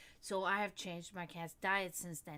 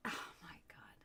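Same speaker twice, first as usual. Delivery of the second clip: whisper; 5 semitones lower, 12 dB quieter, faster than usual. Real, not acted. Whispering an aside.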